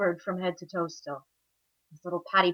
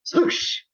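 voiceless whooshing splash noise; whispered swoosh. A narrator's reading. Storyteller imitating the slide into mud, breathy swoosh. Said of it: "all he hears from behind him is".

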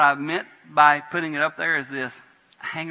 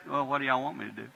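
quieter, unbothered, quick; calm, unreactive; 6 dB quieter, faster than usual. Retold or imitated. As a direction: say it calmly, refusing the bait. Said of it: "he would do like this".

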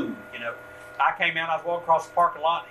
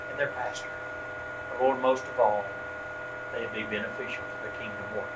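closing prayer; 5 semitones lower than usual. Acted. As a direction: lead the congregation in a hushed prayer.

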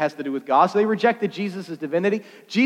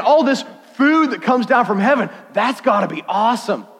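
lightly acted excitement, higher and louder; raised, eager pitch; excited crowd; 6 semitones higher, 4 dB louder than usual. Crowd acted, excited about bread.

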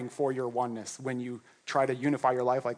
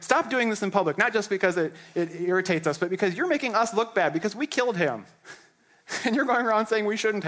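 higher, whiny complaint; annoyed Sadducees; 6 semitones higher than usual. Acted. Sadducees whining to the apostles, higher and petulant. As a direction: complain like offended leaders.

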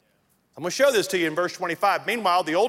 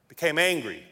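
ordinary talk

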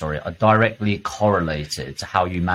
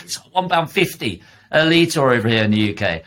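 ordinary talk

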